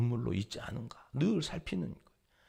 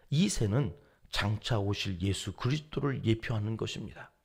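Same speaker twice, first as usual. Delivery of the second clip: ordinary talk, nothing marked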